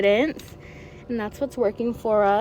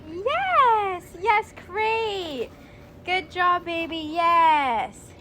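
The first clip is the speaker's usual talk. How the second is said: high, bright sing-song praise, louder; happy puppy praise; 7 semitones higher, 4 dB louder, much slower than usual. Real, not acted. Trainer praising the puppy for going to her crate, high and excited. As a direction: gush over the puppy like she's a star.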